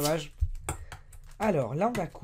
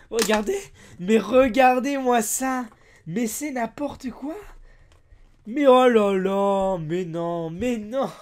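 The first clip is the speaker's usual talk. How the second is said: stupéfait; 4 semitones higher, 6 dB louder, much slower than usual. Real, not acted. Déballe un objet absurde.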